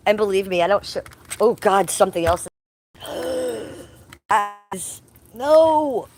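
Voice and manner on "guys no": real alarmed no; shocked gasp. Real, not acted. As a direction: gasp then loud high no. Finding a lantern fly.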